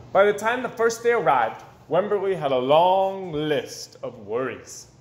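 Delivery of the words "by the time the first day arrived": usual speaking voice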